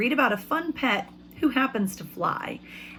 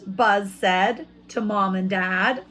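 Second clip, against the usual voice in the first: slow, louder, higher read; storybook reading; 4 semitones higher, 4 dB louder, slower than usual. A narrator's reading. Teacher reading to her class, dramatic slow narration. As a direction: stretch out the fly's anger for the kids.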